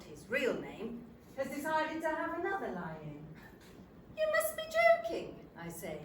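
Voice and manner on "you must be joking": high-pitched, loud disbelief; incredulous. Acted. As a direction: react in sheer disbelief. Disbelief at nurse's news.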